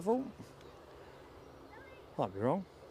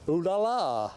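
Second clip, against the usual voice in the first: mock French; 5 semitones higher, 5 dB louder than usual. Cheeky French exclamation after Nantes.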